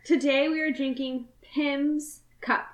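Pim's Cup said slowly with weight; emphasis on Pim's. Shown as a demonstration. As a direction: lean on Pim's Cup, drawn out. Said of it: "sorry, I had to put emphasis on that".

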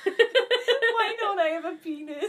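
high, loud, drawn-out mock anguish; over-the-top wail with a laugh in it; hammed-up belted quote. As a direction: belt it out as a dramatic question. Quoting the show's song, belted.

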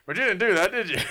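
high and loud, sly accusing reporter voice; Mankiewicz impression. Quoting the Mankiewicz gotcha to his podcast co-host, loud. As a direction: lean in like a gotcha interviewer. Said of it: "Bill Hader's impression of Josh Mankiewicz".

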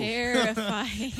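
drawn out. Real, not acted. Drawn out fear at picture.

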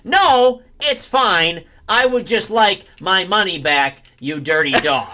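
high, loud, slow rebuke; high loud dismissive voice; David Lynch. Acted. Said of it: "David Lynch going to".

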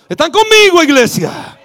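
loud, high shout to the crowd; rally the church; calling out. Real, not acted. Pastor calling out to the congregation, loud and high.

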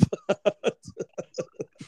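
high, voiceless chuckling fit; high breathy laugh; laughter. Real, not acted. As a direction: burst into a long wheezy laugh. Laughs at Mark's way-off predictions.

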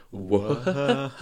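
ordinary talk, nothing marked

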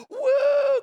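joyful "Woo" shot up high; excited cheer. Retold or imitated. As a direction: cheer like your kid just rode off. Cheering the kid on the bike.

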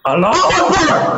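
shouted high with a laugh; hammed-up fanatic yell; mock battle cry. Mock zealot war cry.

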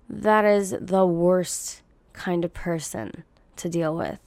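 normal talking voice

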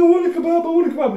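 put-on high-pitched whiny drunk begging; imitated tearful pleading; mock crying. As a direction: whine it like a tearful child. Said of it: "I cried for a kebab".

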